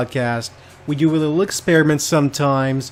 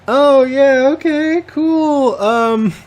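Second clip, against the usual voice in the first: forced cheer; 10 semitones higher, 5 dB louder, much slower than usual. Real, not acted. Forced cheer at cannibal news.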